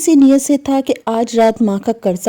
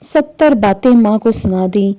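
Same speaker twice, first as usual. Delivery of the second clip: ordinary delivery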